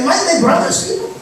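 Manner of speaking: a hint of insolent scorn; rude retort. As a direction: throw the question back at God. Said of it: "Napakabastos ni Cain".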